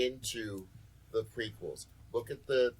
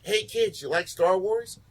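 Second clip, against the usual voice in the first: bright, higher mock grown-up asking kids; chipper talk to kids; 7 semitones higher, 6 dB louder than usual. Scholar asking his kids, mock cheerful question. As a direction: sound like a dad quizzing his kids. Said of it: "He's like".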